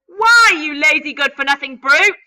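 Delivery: loud, high shouted insult; loud furious aunt. Acted. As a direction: shout it at him. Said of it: "Aunt Spiker shouted at him".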